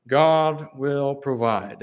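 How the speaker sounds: very slow, each word weighted; a slow, solemn "God" at the front; slow emphatic quote. Acted. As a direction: drag out "God will provide" deliberately. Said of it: "highlight these words".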